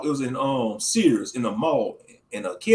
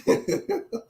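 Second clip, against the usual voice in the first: breathy laugh. Real, not acted. Laughs at own impression.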